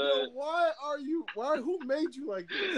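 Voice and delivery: pleading with a teasing friend; exasperated. Real, not acted.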